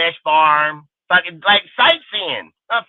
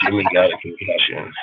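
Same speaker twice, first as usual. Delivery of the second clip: lowered, unlike-himself voice for the caller; put-on fan voice; 11 semitones lower than usual. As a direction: read the fan's question in a deep dopey voice. Reads a fan's chat question.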